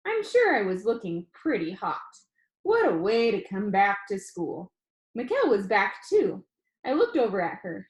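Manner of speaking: lively first-person read-aloud to a class; story read aloud. A narrator's reading. Teacher reading the class novel aloud, lively narration. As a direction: read the chapter to the students.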